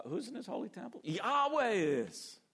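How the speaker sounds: the answer rings out on "Yahweh is"; stress on Yahweh. Shown as a demonstration. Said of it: "is in the emphatic position in the sentence".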